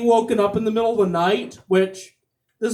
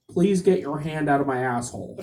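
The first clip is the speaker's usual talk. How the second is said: much lower, softer deadpan line; deadpan husband; 6 semitones lower, 5 dB quieter than usual. Acted. Husband delivering the punchline to his wife, low deadpan. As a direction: drop it flat and low as the husband.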